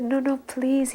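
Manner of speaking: put-on high, childlike begging; tearful plea. Weeping disciple begging his guru, high and childlike. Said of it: "weeping like a little baby".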